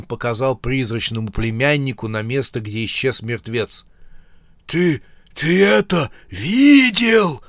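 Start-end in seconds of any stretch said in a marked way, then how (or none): 4.7-7.4 high, slow, shaky stammer; trembling voice. Acted. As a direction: stutter in shock. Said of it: "дрожащим голосом произнёс дядюшка".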